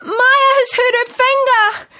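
high loud panicked call; yelling. A narrator's reading. Lucy calling Mum about Maya's finger, high loud yell. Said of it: "yelled Lucy".

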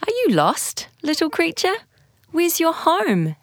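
put-on a small boy coaxing a lost creature; reading aloud as little Finn; gentle boy's voice. A narrator's reading.

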